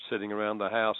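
normal talking voice

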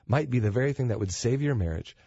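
ordinary delivery

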